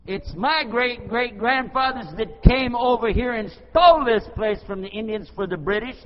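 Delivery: full-voiced indignant shout, high and drawn out; hollering. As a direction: roar the retort, stretching the key words. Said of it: "I hollered at the guy".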